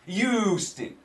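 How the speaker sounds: proudly drawn-out "Houston" starting with a Y; New York pronunciation. Shown as a demonstration. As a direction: lean into the YOO of "Houston", no H. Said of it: "that's how I say it with my New York accent".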